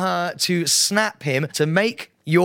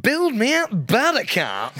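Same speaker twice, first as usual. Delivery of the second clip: higher, slow, drawn-out DJ announcement; mocking DJ voice; 7 semitones higher, slower than usual. Host parrots the DJ impression, slow hammed-up. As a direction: echo the friend's cheesy DJ line back at him. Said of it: "that accent".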